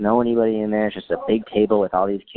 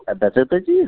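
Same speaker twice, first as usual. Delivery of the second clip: raised-pitch mock-French reply; pretend French; 5 semitones higher, 4 dB louder than usual. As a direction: answer in made-up French. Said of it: "pretended to speak French".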